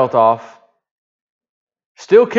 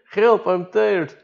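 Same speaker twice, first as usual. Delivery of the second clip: high, slow, drawn-out whimper; whiny tired plea; 7 semitones higher, much slower than usual. Retold or imitated. A tired diver whining to the boat crew, high and slow. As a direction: stretch it out like an exhausted man pleading. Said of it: "we just say it".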